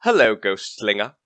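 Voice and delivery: low, sly villain greeting. Acted. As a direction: greet the hero like a lurking villain. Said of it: "What happened to his voice?".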